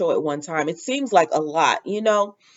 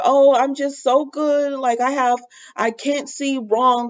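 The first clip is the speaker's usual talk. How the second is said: higher-pitched mocking imitation; mock self-righteous; 6 semitones higher than usual. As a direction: mimic someone oh-so-pleased with their own goodness. Mocking Sean's self-righteous attitude.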